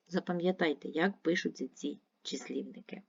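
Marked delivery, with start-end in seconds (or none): none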